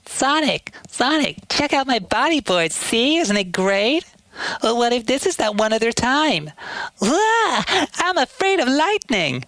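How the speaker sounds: put-on very high, excited kid voice; Tails voice. Kid fox showing off to Sonic, very high. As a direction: squeak with kid glee and fright. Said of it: "and then Tails".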